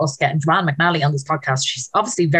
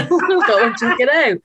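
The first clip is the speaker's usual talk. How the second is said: high, laughing delivery; 6 semitones higher than usual. Real, not acted. Host joking about scouting the bar with her friend, laughing. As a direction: say it through a laugh.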